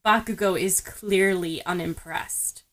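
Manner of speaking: ordinary delivery, nothing marked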